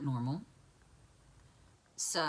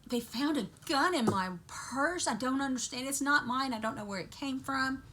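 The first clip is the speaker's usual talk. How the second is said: higher, pleading mother's voice, insisting; frantic mother; 7 semitones higher, 3 dB louder than usual. Retold or imitated. Mom to son after arrest, a higher, distraught plea. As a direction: protest her innocence as the mom. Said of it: "She said".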